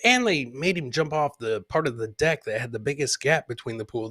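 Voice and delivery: ordinary talk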